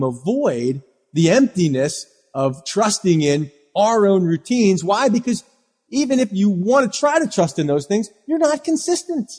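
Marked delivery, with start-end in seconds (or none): none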